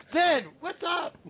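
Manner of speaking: high, drawn-out hail with laughs round it; real gleeful welcome; excited greeting. Real, not acted. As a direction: call out to a friend, stretched and high. Host calls out to his guest.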